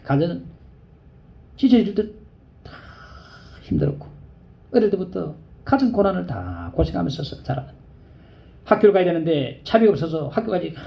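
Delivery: usual speaking voice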